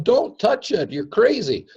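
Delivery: high, loud alarmed cry of don't touch it; frightened bystander yelling a warning; freaked-out warning. Retold or imitated. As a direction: squeal it like a scared onlooker. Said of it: "They're going".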